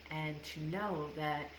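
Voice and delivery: ordinary talk, nothing marked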